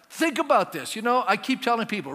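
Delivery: ordinary delivery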